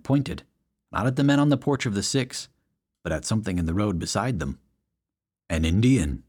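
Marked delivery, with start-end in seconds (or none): 5.5-6.2 lower, solemn announcement; a light big-man announcement; announced. A narrator's reading. Big man pointing out something in the road, low announcement. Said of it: "he announced".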